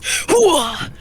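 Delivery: loud, punchy army grunt; gung-ho soldier. Retold or imitated. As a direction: bark it like an eager soldier. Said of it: "and you're just".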